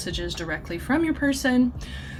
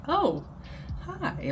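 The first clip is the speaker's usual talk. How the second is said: higher and softer, an awkward "oh, hi"; awkward greeting; 8 semitones higher, 8 dB quieter than usual. Retold or imitated. Two people bumping into each other after time apart, awkward high greeting. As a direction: sound caught off guard and uneasy. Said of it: "it's awkward".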